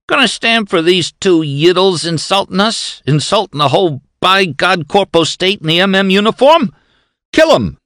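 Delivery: high, loud egging-on; a guard goading his boss to kill; demanding thug. A narrator's reading. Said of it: "demanded of McGoblin".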